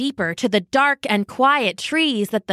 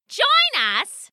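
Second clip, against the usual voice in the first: high shriek of scorn; shrieking sparrowhawk; 12 semitones higher than usual. Acted. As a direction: shriek it like a hawk. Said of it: "she shrieked".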